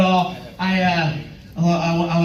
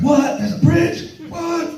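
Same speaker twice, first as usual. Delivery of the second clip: higher, incredulous What; mock surprise; 9 semitones higher, slower than usual. Comedian reacting to the tour guide's haunted bridge. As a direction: act baffled that a bridge exists. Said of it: "I was like".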